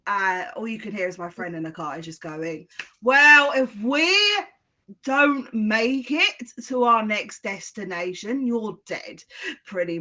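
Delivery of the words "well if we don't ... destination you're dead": loud, higher mock threat in the car; half-joking yell of a threat. Friend threatening her partner in the car, loud mock yell.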